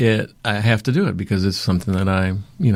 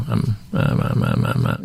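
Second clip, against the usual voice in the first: stuttering. Retold or imitated. Nervous employee can't get the refusal out, stuttering. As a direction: stammer on the first word, stuck.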